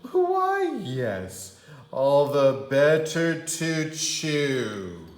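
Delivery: high squeal then slow loud growl; wolf as granny. Acted. Wolf as Grandma revealing his teeth. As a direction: let the wolf slip through.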